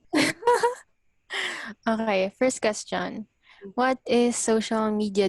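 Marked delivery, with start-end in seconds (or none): 0.2-0.8 high-pitched burst of giggling; high giggle. Real, not acted. Host giggling at the banter, high laugh. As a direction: giggle at the silliness.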